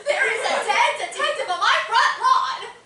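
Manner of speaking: blurting out the shocking news; half-laughing alarm; panicked outburst. Acted. Discovering a dead body, panicked.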